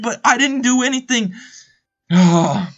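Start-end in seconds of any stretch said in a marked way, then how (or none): 2.1-2.7 big spent sigh; breath-catching exhale. Real, not acted. Commentator needing a breath after yelling, spent exhale. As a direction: let all the air out, winded. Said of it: "I need a breath for a second".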